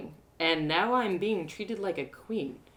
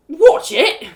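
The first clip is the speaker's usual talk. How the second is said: a sudden, much louder outburst; startled shout; 9 semitones higher, 16 dB louder than usual. Acted. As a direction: shout it at the man who got splashed. Ale spat on the man.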